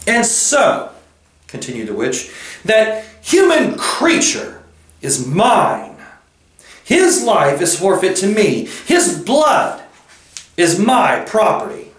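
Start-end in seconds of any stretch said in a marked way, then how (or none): 0.1-0.9 high, haughty witch voice; resume haughtily, pitched high; imperious witch. A narrator's reading. Witch continues her claim. 2.6-11.9 high, possessive witch voice; imperious witch. A narrator's reading. Witch continues her claim.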